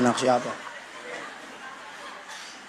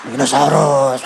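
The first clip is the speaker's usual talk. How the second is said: put-on low, sleepy little-boy mumble of dinosaurus; doing his small son just woken up; little son's voice; 8 semitones lower, slower than usual. Preacher mimics his son waking up, low sleepy child voice. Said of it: "langsung begini dia".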